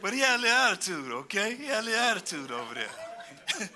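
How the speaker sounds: can't stop chuckling at his own joke; laughing. Real, not acted. Preacher laughing with the congregation at his joke.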